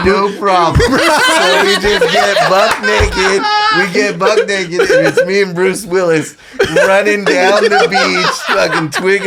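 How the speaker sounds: high, laugh-filled retelling; can't stop laughing at the memory; laughing while telling. Real, not acted. Telling hosts about skinny dipping with Bruce Willis, laughing.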